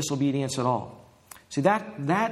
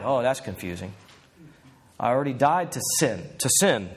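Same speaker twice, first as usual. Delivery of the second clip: ordinary talk, nothing marked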